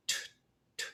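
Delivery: bare "t" made with the tongue, no vowel; isolated "t" sounds, spaced apart; tongued T demo. Shown as a demonstration. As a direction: say "t" as just the tongue closing on the air. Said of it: "close with a T".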